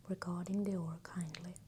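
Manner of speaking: ordinary delivery, nothing marked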